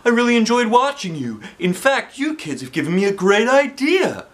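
high, loud beaming praise; delighted teacher; cheerful principal. Acted. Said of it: "with a big smile".

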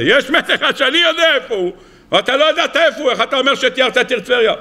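high-pitched, taunting accusation; mocking challenge. Gentile out to ridicule the rabbi, mocking challenge. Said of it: "אני אצחק עליו".

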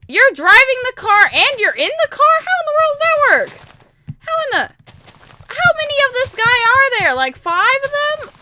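high, loud, baffled questioning; incredulous. Real, not acted. Player asks how someone drives and rides at once, incredulous. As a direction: demand an explanation, pitch leaping up. Baffled by game character in car.

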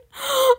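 loud, high gasp; overjoyed gasp. Pretend. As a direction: gasp as if overwhelmed by joy. Overjoyed at pets in trade offer.

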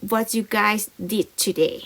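very slow, careful read; slow model reading. Shown as a demonstration. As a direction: spell it out slowly for learners.

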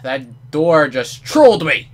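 loud, slow, indignant at the door; mock outrage. Real, not acted. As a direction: yell at the door like it insulted you.

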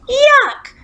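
very high, loud yuck and gagging; disgusted yell. A narrator's reading. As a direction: shriek it out like you bit into clay. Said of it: "she yelled".

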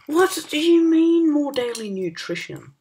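high, loud, slow yell of disbelief; exasperated shout at the screen; frustrated yell. Real, not acted. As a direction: bellow the question, stretching it out. Frustrated at the game.

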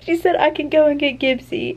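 high, loud, emotional telling; overwhelmed read-out. Real, not acted. Reader retells the scene, still overwhelmed. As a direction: push the words out while melting. Still overcome while reading on.

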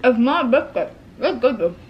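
chewing while talking; speaking around food; mouth full. Real, not acted. Seen 1: Capped speaker chews tea cake, cheeks full, holding bitten cake.

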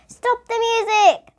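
high, loud, slow shout. A narrator's reading. Teacher silencing the noisy class, loud shout. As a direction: cut the noisy room off sharply. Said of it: "Miss Jules suddenly shouted".

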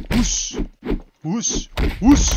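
high, loud breathy whooshing; mock whoosh noises. Taunting players with high whooshing noises. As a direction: whoosh at them like a spooky ghost.

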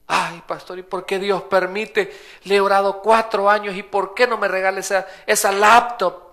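mock a petty believer begging God; whiny believer.

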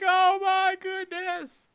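high, slow, drawn-out gasp; mock shock. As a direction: stretch out a high mock cry. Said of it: "and they're like".

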